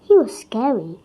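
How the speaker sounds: ordinary delivery, nothing marked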